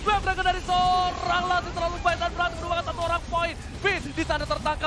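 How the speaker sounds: loud hype esports casting; live play-by-play. Real, not acted. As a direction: call the final push at full energy. Live play-by-play of final fight.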